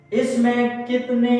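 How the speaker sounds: normal talking voice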